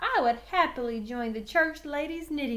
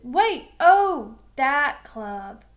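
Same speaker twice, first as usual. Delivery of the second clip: loud burst of dawning recognition; sudden realization; 11 dB louder, much slower than usual. A narrator's reading. Chuck suddenly remembering the club, loud and surprised. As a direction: blurt it out as it hits you.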